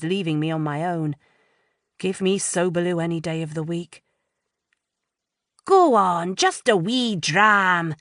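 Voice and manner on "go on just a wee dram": slow, bad Scottish accent; mock Scottish accent coaxing a friend; terrible Scottish accent. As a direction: ham up a bad Scottish brogue. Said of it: "she says in a terrible Scottish accent".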